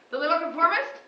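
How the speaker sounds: ordinary talk, nothing marked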